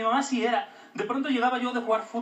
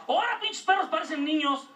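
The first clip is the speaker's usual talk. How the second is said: high mock mother shout; higher mock mom yelling; mom yelling at dogs; 10 semitones higher than usual.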